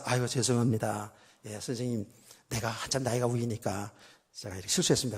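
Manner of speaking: low, soft, deferential apology; humble apology. Retold or imitated. Older driver apologizing to a younger one, humble and soft.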